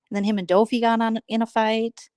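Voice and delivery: ordinary talk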